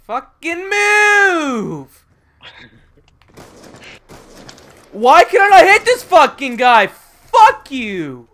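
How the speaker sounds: furious yelling at the screen; raging gamer. Real, not acted. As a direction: scream in gaming rage. Raging at the game.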